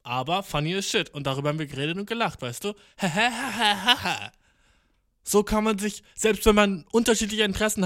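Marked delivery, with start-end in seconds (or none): 3.0-4.3 breathy burst of laughter; short real laugh. Real, not acted. Laughing about the chat.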